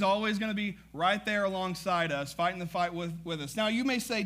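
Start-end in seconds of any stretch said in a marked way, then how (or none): none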